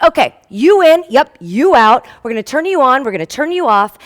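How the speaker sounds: air traffic controller. Retold or imitated. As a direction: play a busy controller barking quick orders.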